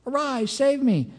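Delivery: high, drawn-out mock plea; crying out. Preacher imitating idolaters begging for rescue, crying out. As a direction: call it up high and slow, imitating someone in trouble. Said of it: "you cry out".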